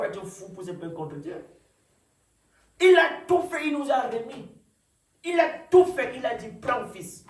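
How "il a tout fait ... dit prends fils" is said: loud, high preaching, punched phrase by phrase; prédication enflammée. Real, not acted. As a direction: proclaim it forcefully to the congregation. Preaches at full force. (prédication à pleine voix)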